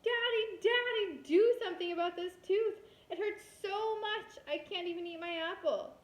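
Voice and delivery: high, loud whiny yell; a child wailing loudly at a hurting tooth; yelling in pain. Acted. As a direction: call for Daddy over the hurting tooth. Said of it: "and yelled".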